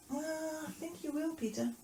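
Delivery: high, soft doubtful reply; Jesus replying. Acted. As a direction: answer gently in a lifted voice. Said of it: "And Jesus said".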